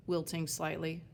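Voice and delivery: ordinary delivery, nothing marked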